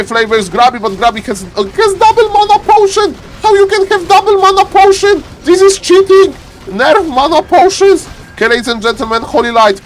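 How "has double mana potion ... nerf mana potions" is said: high-pitched, indignant complaint; exasperated caster in a high squawk; outraged protest. Real, not acted. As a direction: cry foul at the game, voice pitched high.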